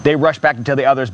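ordinary delivery

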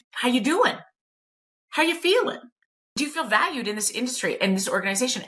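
high, sing-song mock concern; mocking check-in. Trainer asking staff how they feel, sing-song mockery. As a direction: parody a trainer's caring questions.

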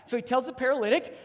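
ordinary talk

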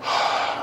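long breathy sigh before answering; heavy exhale, acted; imitated weary sigh. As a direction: sigh like someone who is struggling. Acts struggling woman's sigh before answering.

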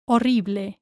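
Horrible as o-RREE-bleh; final E sounded. Shown as a demonstration. Teacher models for learners, Horrible with final E. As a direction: finish Horrible on a clear "eh". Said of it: "remember to pronounce the E".

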